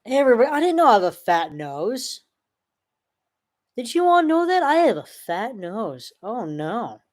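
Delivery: high, fast hammed-up fake dismay; mock distress. Streamer mocking a fat-nose insult with fake dismay. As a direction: overplay hurt feelings to mock. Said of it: "Just playing".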